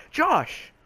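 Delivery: high, falling shout of a name; imitating angry teacher. Teacher catching another kid out, calling out sharply.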